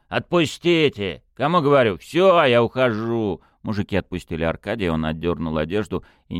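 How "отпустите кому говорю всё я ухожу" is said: loud, slow angry growl. A narrator's reading. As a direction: snarl it out furiously. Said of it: "рыкнул".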